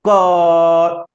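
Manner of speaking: क released, then its vowel held very long; drawn-out consonant. Shown as a demonstration. Teacher showing only the vowel lengthens, drawn-out क. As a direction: stretch क into a long steady vowel tail. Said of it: "इस व्यंजन के उच्चारण को लंबा करेंगे".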